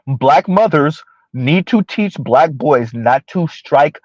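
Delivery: slow, measured emphasis on each word; slow and deliberate. Real, not acted. Host relaying the caller's demand, slow and deliberate. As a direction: drive it home slowly. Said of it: "gonna talk slow so I can be very deliberate".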